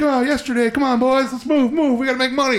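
high, pumped-up crew boss patter; enthusiastic rallying. Retold or imitated. New driver rallying the boys to make money, enthusiastic. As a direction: gee up the boys, eager and pushy. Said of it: "Like, I'm all enthusiastic".

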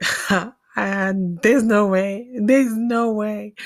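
very slow, drawn-out refusal; adamant refusal. Retold or imitated. Daughter refusing to prep the chicken, adamant. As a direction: stretch every word out in disbelief.

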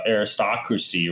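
ordinary talk, nothing marked

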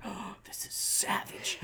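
slow, hushed mock amazement; awed whisper. Son reacting to taking the car, awed whisper. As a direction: whisper it slowly, as if awestruck.

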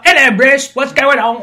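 loud, high-pitched mock Cockney greeting; botched Cockney. Sherlock greets Bruce again in loud, wrong Cockney. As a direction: overdo a bad Cockney accent, laughing. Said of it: "I know he's not Cockney either".

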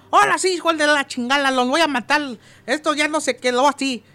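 angry mock Chinese accent. Chinese man curses at the attacking dogs in a mock Chinese accent. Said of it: "Y el chino".

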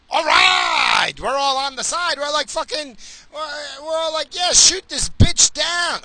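loud, high mock cheer; hammed-up high-pitched yelling; rallying crowd shout. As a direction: shout it like a rowdy crowd all in agreement. Voicing Americans cheering the shoot-down.

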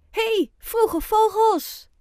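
cheerful call. A narrator's reading. Annemarie greets the early boys with a loud, high call. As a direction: call out across the yard.

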